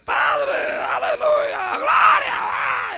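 high, loud shouted prayer cries; shouted praise. Retold or imitated. Praying on the floor when the bell rang, shouting praise. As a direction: yell the praise at the top of your voice. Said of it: "y tenías que gritarlo".

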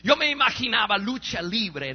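ordinary delivery

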